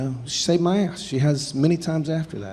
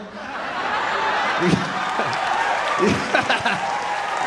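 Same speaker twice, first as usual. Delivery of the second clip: breathy laugh; 5 semitones higher than usual. Real, not acted. Audience-shared laugh.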